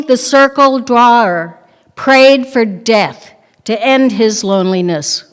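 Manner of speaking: ordinary talk, nothing marked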